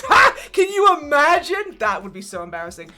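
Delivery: mock his scorn, loud then settling; hammed-up sneering laugh; scoffing villain. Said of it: "And he goes".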